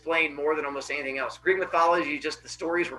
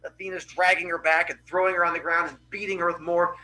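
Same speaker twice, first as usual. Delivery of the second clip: ordinary delivery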